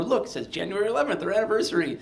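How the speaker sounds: proudly showing off the date; excited show-off. Retold or imitated. Showing girlfriend the cube.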